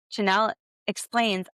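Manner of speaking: ordinary talk, nothing marked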